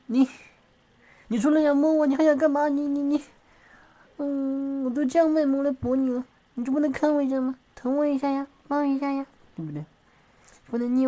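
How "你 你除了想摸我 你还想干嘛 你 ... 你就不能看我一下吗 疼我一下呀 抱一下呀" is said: higher, louder petulant whine; a high, complaining coo for affection; whiny pleading. Acted. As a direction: sulk and beg to be cuddled.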